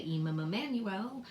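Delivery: "Emma Manuel" as one name with the m repeated; wrong doubled sound. Shown as a demonstration. Teacher showing how not to read a name, m sound repeated. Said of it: "We don't say".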